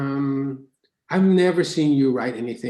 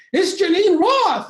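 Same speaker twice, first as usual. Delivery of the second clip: "Janine Roth" squealed much higher and slower; starstruck; 8 dB louder, slower than usual. Retold or imitated. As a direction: gasp out "Janine Roth" in awe. Fans' starstruck mention.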